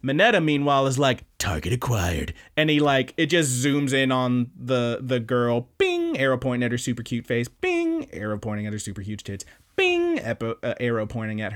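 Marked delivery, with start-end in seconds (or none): 1.4-2.3 low targeting-computer readout; deep, deadpan lock-on announcement; mock targeting voice. Mineta locks on to the girl, mock low targeting voice. 5.8-6.2 high-pitched cartoon ping; high bing sound. Arrow pops up on screen, high bing. 7.5-8.1 high-pitched cartoon ping; high bing sound. Arrow pops up on screen, high bing. 9.7-10.2 high-pitched cartoon ping; high bing sound. Arrow pops up on screen, high bing.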